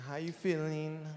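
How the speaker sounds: soft, slow coddling parent voice; mock-tender modern parent; lightly voiced gentle parent, hushed and slow; soft understanding parent. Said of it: "today's parents ... like".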